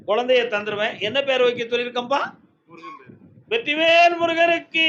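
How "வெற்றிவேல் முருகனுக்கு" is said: slow, loud and higher call to the god; drawn-out devotional shout; ritual cry. Real, not acted. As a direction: cry it out like a temple invocation.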